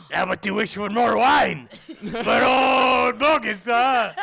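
loud, hammed-up French djinn; boisterous bad French accent, laughing; horrible French accent. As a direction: shout it in a terrible French accent. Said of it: "That's a horrible French accent".

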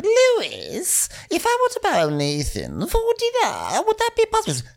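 very high, put-on wheedling boss; Faye impression voice. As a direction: ask in a squeaky-high imitation of Faye. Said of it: "Faye is like".

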